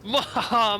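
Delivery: villainous mwahaha; evil laugh. Pretend. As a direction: gloat like a mad scientist. Streamer gloats over taking stream.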